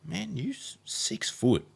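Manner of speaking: lightly voiced pushy mate. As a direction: pitch up as an excited friend. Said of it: "mates ... were like".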